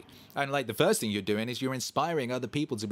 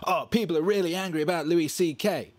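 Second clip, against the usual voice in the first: mocking outrage; 8 semitones higher, 5 dB louder than usual. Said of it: "It'd be like".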